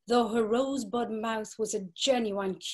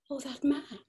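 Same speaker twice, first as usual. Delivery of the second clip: breathless whispered sigh; 4 semitones higher than usual. Acted. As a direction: whisper it as a secret thrill. Gertie's secret longing.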